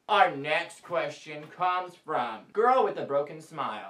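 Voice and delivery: ordinary talk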